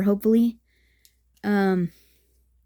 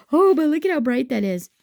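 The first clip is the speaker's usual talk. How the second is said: higher and louder, amazed; surprised; 6 semitones higher, 4 dB louder than usual. Real, not acted. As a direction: gasp at how bright it came out. Reveal of the colors.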